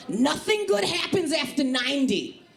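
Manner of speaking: high-pitched anxious East Coast aunt; Aunt Sherry. Acted. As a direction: declare it as a high, fretful aunt. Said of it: "I do that impression".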